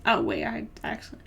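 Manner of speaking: ordinary delivery, nothing marked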